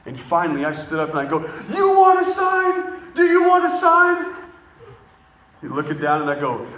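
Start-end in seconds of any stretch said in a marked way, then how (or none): 1.8-4.3 challenging shout. Retold or imitated. Challenging the angry student.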